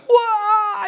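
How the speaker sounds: sudden high shouted whoa of discovery; surprised whoa. Retold or imitated. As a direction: yell it out, stunned by the find. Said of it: "he's like".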